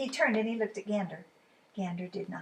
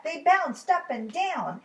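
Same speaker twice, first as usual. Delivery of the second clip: usual speaking voice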